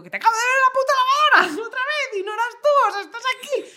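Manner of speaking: high, loud, fast hysterical yell; anxiety-attack outburst. Retold or imitated. Daughter confronting mother about the washing machine, hysterical yelling. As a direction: shriek it at top pitch, racing. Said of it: "ataquito de ansiedad".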